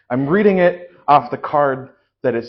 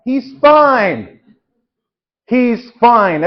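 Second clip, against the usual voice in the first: mock reassurance; 7 semitones higher, 7 dB louder, much slower than usual. Priest character defends the fall.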